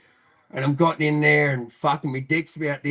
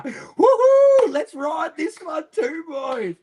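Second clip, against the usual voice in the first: very high party cheer; loud whoop, then a high, drawn-out cry to the gang; euphoric whooping; 8 dB louder, much slower than usual. Retold or imitated. Whooping in back of police wagon.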